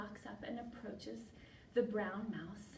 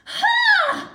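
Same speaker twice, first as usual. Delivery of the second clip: huge high yelp, then loud indignation; hammed-up fury at full volume; outraged lizard; 6 semitones higher, 21 dB louder than usual. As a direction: explode like a spurned, melodramatic suitor. Rejected lizard explodes.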